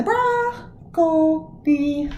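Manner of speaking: "broccoli" drawn out slowly, high BROC, then co, li; stress on BROC. Shown as a demonstration. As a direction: break "broccoli" into slow syllables, leading on BROC. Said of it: "where does the stress go?".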